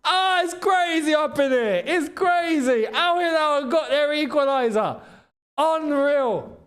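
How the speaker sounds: real amazement at the goal; excited disbelief. Real, not acted. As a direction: shout it out in disbelief. Reacting to a late equaliser.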